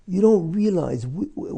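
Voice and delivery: ordinary delivery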